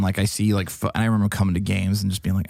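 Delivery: ordinary delivery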